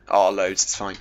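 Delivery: ordinary talk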